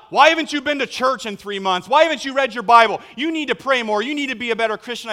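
loud, high-pitched berating; shouted accusing tirade, fast and high; hammed-up scolding. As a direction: lay into them with rapid-fire demands. Mock scolding, contrasted with God.

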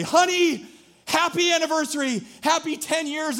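hammed-up eager husband with roses; mock chipper husband. Husband greets wife on tenth anniversary, high and bright. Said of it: "And I come with the roses and I say".

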